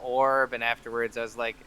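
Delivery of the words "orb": normal talking voice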